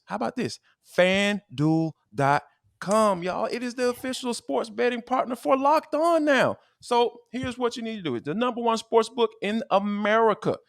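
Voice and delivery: high, pushy sponsor pitch to listeners; podcast host hyping a sponsor deal, energetic ad read; upbeat ad read. Acted. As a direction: sell the betting offer like a radio spot.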